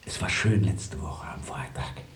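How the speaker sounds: a slow, intimate whisper. Acted. Lover recalling their Friday together, whispered slowly. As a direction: hush it, tender and secret.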